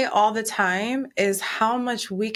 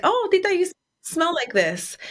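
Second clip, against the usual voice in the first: lightly voiced daughter, pitched up; retold daughter; 10 semitones higher than usual. Daughter recognizes her aunt's perfume, lightly voiced in a higher pitch.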